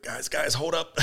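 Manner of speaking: urgent call to stop, laughing through it; laughing hold-up call. Retold or imitated. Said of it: "I was like".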